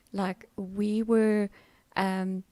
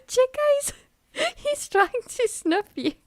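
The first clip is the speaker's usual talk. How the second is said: high amused voice; 13 semitones higher, 8 dB louder than usual. Real, not acted. Talking about the dog.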